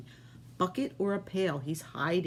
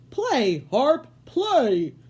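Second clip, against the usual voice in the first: louder, higher command; giant commanding; 8 semitones higher, 9 dB louder, much slower than usual. Acted. Giant commanding his harp, loud. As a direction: order the harp to play. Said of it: "commanded the giant".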